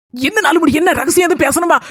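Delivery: ordinary talk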